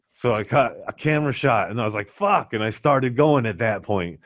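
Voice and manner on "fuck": sudden high yelp of annoyance; frustrated curse. Retold or imitated. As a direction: blurt it out, caught off guard. Frustrated swearing mid sled run.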